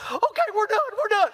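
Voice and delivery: high-pitched mock kid voice; hammed-up falsetto relief; frantic son. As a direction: blurt it out desperate to get off. Frantic son jumps at the offer.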